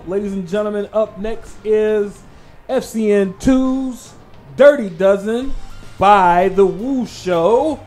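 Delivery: slow, loud, high ring announcer. Acted. Announcing the countdown list.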